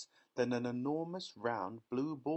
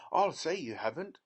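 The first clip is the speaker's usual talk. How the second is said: higher, louder angry retort; cried out; 6 semitones higher, 6 dB louder than usual. A narrator's reading. Mother rebuking factory owner, angry cry. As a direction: snap back at him. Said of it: "cried Missus Beauregard".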